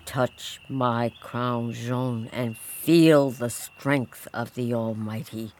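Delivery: low, slow commanding angel; archangel voice. Acted. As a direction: speak as a stern archangel. Voice of Saint Michel.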